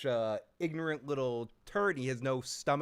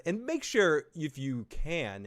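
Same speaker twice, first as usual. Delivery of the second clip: normal talking voice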